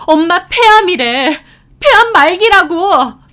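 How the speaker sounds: high, loud yell, slowing to hammer it home; anguished shout. A narrator's reading. Sister breaking the cancer news to her brother, anguished shout. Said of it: "제가 소리 지르자".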